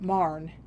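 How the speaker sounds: "Marne" as one drawn-out syllable; local dialect pronunciation. Retold or imitated. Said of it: "called it Marne".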